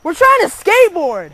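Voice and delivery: angry shout. Real, not acted. Kid shouting back at neighbour.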